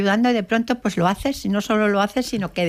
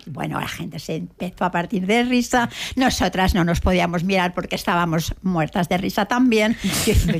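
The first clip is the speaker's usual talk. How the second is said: normal talking voice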